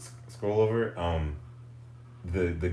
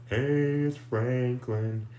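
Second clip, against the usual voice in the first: theme song voice; 4 semitones higher than usual. Retold or imitated. Imitating the Franklin theme song.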